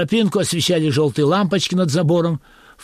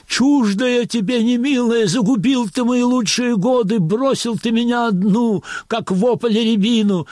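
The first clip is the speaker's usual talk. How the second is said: holding back sobs; 6 semitones higher than usual. Acted. Actress lamenting to her stage husband, high and tearful. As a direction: wail it like a wronged village wife, higher. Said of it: "с трудом подавляла рыдания".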